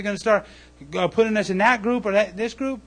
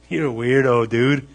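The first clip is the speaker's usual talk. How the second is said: taunting neighbor; 7 semitones lower than usual. Retold or imitated. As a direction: lower mocking jeer.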